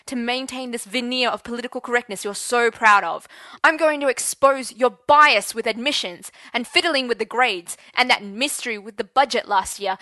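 loud, angry tirade; furious defiance. Acted. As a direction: shout down the headmaster. Teacher defies headmaster, threatening.

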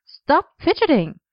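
high, drawn-out sharp order; sharp, exasperated command; sharp scolding. A narrator's reading. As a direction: scold them sharply, pitch jumping up. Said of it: "slapped his arse with a sharp".

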